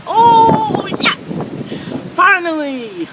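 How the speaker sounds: shrieking with joy in the storm; excited. Real, not acted. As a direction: yell it out, thrilled. Thrilled yelling in the storm.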